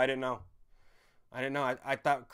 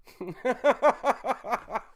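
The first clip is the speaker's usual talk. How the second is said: laugh; 10 semitones higher, 8 dB louder than usual. Real, not acted. Laugh after frustration.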